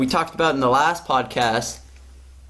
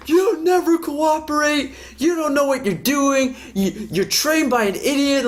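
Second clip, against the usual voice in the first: higher, hammed-up angry yell; mock explosion; 9 semitones higher than usual. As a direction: imitate a coworker blowing up. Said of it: "man just explodes".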